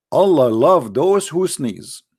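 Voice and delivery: usual speaking voice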